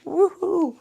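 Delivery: high, loud woohoo; bright little cheer to reset; playful whoop. Real, not acted. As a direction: let out a high-pitched whoop. Mock cooling off after a rant.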